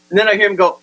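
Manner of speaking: ordinary talk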